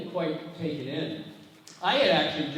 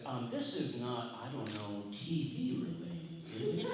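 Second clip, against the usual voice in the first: sheepish; 2 semitones lower, 7 dB quieter than usual. Retold or imitated. Man asking a caller about television, acting sheepish and hesitant. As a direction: feel your way awkwardly to the question. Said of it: "I felt really stupid".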